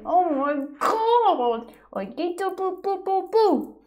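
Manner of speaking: high squeal, drawn-out oh my God; squeal, bursting with cuteness overload; overwhelmed by cuteness. Real, not acted. Squealing over the cute toy.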